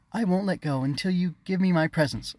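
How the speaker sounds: put-on high-pitched insistent little girl; exclaiming. A narrator's reading. Sister clinging to brother for presents, insistent exclaiming. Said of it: "she exclaimed".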